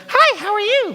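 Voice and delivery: over-sweet hostess greeting at the door; Abby's hostess voice. Retold or imitated. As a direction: chirp the hello like an eager hostess. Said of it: "that's what she sounds like".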